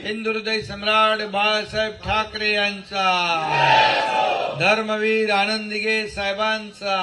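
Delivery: slow chanted war cry, loud; rally the crowd with long chanted cries; roaring rallying speech. Real, not acted.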